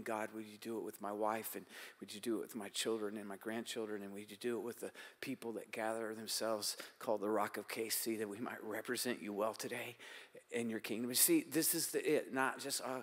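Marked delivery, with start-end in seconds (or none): 0.0-11.1 softer, tender prayer for family; interceding for family and church, soft prayer; soft intercession. Acted. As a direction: pray gently for wife and children.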